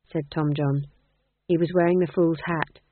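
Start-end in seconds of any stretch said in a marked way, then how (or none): none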